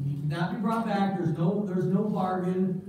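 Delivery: usual speaking voice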